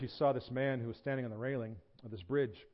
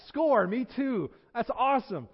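high, loud victory cheer; acted jubilant excitement; elated agreement; 10 semitones higher, 7 dB louder than usual. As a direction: whoop it like scoring a point. Preacher ever more thrilled at match.

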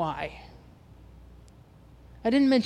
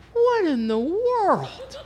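much higher and slow, caught off guard; stunned; 13 semitones higher, 3 dB louder, much slower than usual. Retold or imitated. Shocked at the door hitting her car, stunned and high. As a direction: let the shock stretch out the words.

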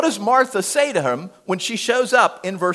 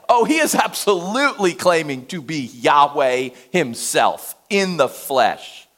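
normal talking voice

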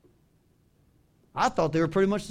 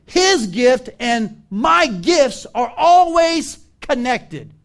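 loud, slow, raised preaching voice; emphatic preaching; 5 semitones higher, 9 dB louder, slower than usual. Real, not acted. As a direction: preach it out with weight on each phrase. Preacher expounds the verse forcefully.

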